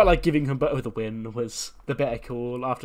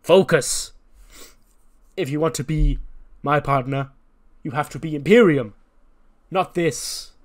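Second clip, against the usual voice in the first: angry barking command; 4 semitones higher, 9 dB louder than usual. Retold or imitated. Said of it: "And then he goes".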